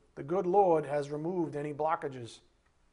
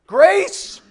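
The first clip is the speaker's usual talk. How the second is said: high, very loud shout of Grace; mock shout; 12 semitones higher, 15 dB louder than usual. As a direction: yell it as you run out the door. Thief shouting grace while fleeing.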